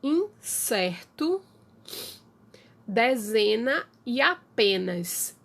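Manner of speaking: slow, spaced examples; slow demo. Shown as a demonstration. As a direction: draw the rhyming words out slowly. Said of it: "então perto". (exemplos ditos devagar)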